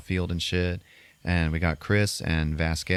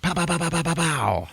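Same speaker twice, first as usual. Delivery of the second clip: high-pitched rapid "pow pow" gunshots; imitated gunfire; 11 semitones higher than usual. Shooter showing off with both guns, imitated gunfire. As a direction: rattle off mock pistol shots. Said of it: "he pulls out both of his guns".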